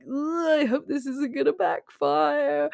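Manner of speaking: high, anxious ugh then worry; worried groan. Retold or imitated. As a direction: voice the audience's dread. Viewer worrying about the fawn.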